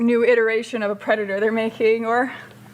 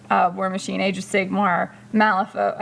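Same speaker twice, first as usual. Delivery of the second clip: ordinary talk, nothing marked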